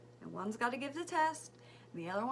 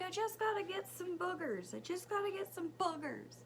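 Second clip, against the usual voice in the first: put-on high-pitched little kid voice; imitated pleading child; 9 semitones higher than usual. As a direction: protest in a mock child's voice. Voicing pleading son during nose test.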